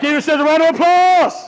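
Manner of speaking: loud, high applause cue; rousing shout. Real, not acted. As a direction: whip up the crowd's applause. Calls crowd to applaud.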